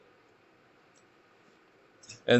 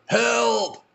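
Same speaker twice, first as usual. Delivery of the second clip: high desperate cries of help; hanging off a ledge, calling up high; yelling for help; 12 semitones higher than usual. Retold or imitated. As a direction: cry out for rescue from the cliff. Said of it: "he yelled".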